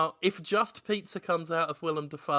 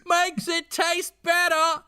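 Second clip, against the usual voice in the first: high slow Fran Drescher imitation; lightly voiced Fran Drescher; 8 semitones higher, 4 dB louder, slower than usual. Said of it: "Says Fran Drescher".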